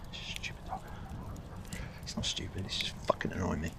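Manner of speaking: hushed, annoyed whisper at the dog; irritated whisper aside; whispered grumble. Real, not acted. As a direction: grumble it under your breath. Muttering at the dog under breath.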